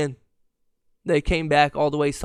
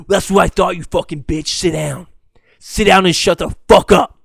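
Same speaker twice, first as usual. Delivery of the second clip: loud bursts of gloating abuse; aggressive heckler; 7 dB louder than usual. Retold or imitated. Grown man heckling a teenager in his ear, aggressive. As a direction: bellow the key words like a taunting fan.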